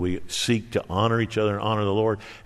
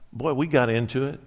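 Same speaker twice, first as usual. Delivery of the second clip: ordinary talk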